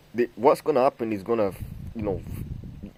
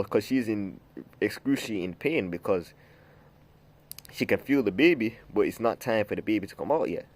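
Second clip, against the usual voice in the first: ordinary talk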